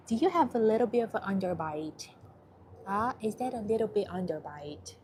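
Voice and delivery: soft, singsong pet talk; cooing to a dog. Real, not acted. Shelter volunteer greeting a shy dog, cooing. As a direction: coo playfully with swooping pitch.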